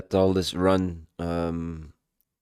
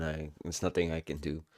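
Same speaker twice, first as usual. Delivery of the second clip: ordinary talk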